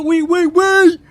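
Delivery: shouted oui oui oui, very high and loud; mock French oui. Streamer finishes French reading with a shouted oui. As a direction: end on a huge comic oui. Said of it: "wrap with a French accent".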